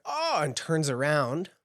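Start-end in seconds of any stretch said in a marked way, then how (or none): 0.0-0.4 a high, jumpy "Oh" of fright; startled oh. Acted. Granny startled by Jinx behind her, a high yelp. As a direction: yelp as if caught off guard. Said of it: "she jumps and she's like".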